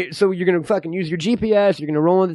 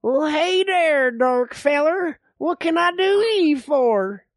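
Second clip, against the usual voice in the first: put-on slow, higher old codger voice; old man; 5 semitones higher, slower than usual. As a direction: drawl it like a backwoods old-timer. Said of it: "I'm the old man".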